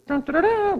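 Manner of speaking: a hammed-up "dun dun dun" trumpet call; mock trumpet fanfare. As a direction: toot a silly fanfare for the show-off. Mocking trumpet fanfare of the boastful.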